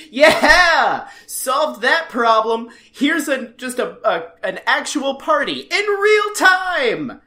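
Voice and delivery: loud, drawn-out celebratory "yeah" energy; party cheer. Retold or imitated. Cast celebrating, acted out.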